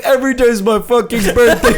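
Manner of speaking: high, fast wrestler retort; mock-outraged quick yell; defiant birthday boy. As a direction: imitate a wrestler's indignant comeback. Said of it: "And he's like".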